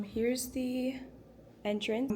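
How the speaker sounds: normal talking voice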